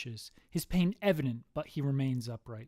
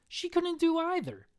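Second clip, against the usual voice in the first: much higher-pitched delivery; squeaked punchline; 14 semitones higher than usual. Acted. Boyfriend tops his joke, high-pitched.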